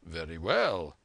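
low, composed assent; calm doctor. A narrator's reading. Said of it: "said the doctor".

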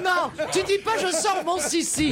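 réprimande amusée. Real, not acted. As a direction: cut in with a high, amused objection. Mock scolding over his lewd phrase. (réprimande amusée, voix haut perchée)

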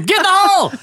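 high-pitched golf heckler holler; mock scream. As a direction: holler it like an overeager golf spectator. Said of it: "it's the first guy to scream".

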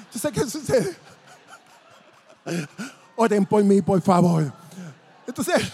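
real laughter breaking into the words; laughing. Real, not acted. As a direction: try to go on preaching through laughter. Preacher cracks up at own joke. (hablando entre risas)

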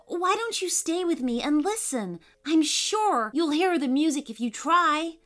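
put-on high, coaxing little girl; Poppy. A narrator's reading. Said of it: "insisted Poppy".

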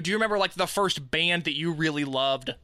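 usual speaking voice

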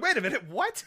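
high-pitched double take; dawning disbelief. Retold or imitated. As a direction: re-enact a sudden baffled realisation. Re-enacting realising it was no dream.

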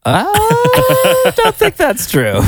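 high, slow incredulous squeal; mock disbelief. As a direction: stretch it out in playful disbelief.

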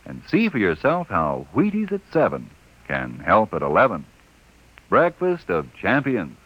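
upbeat radio commercial pitch; ad read. Acted. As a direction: sell the cereal brightly.

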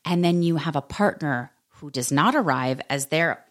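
usual speaking voice

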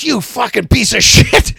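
slow, loud mock yell; high-pitched, hammered-out retold rant; retold furious insult. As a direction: imitate an enraged friend, drawn out. Retold furious text message.

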